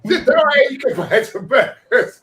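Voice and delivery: high, loud, exasperated acted voice; insistent kid. Kid reassuring a confused trucker dad, insistent. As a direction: brush dad's question off loudly. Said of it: "or daughter or someone's gone".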